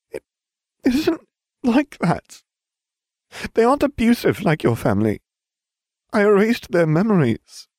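high, tearful voice near crying; a touch of tears, pleading. Acted. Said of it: "Tears filled Hermione's eyes".

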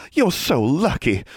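high, slow gushing envy; drawn-out, high excitement; enthusing. A narrator's reading. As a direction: gush it with girlish delight. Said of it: "she enthused".

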